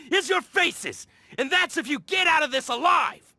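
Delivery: angry shout. Acted. Hoarder leader yelling at the repairers, furious. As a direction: yell at them in outrage. Treasure hoarder threatens them.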